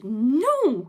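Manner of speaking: loud, rising, indignant outburst. Real, not acted. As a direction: burst out in protest, voice swooping up high. Indignant objection to an app feature.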